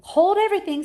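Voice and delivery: high, loud command; urgent call. A narrator's reading. Mister Wonka calls out to everyone, urgent. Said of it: "said Mister Wonka".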